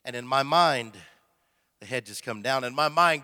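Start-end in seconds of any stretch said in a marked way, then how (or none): none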